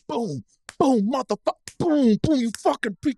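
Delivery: high-pitched mock rage with punching noises; furious, retold. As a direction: act out pounding the steering wheel in fury. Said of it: "I was furious".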